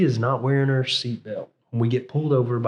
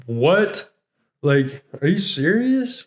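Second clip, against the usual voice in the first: higher, incredulous questioning; retold disbelief; 4 semitones higher, slower than usual. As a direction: retell it as a baffled double-take. Retold shock at being released.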